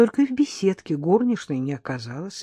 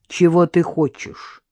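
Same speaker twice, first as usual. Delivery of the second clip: cold; 6 dB louder, slower than usual. A narrator's reading. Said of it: "Холодно произнесла".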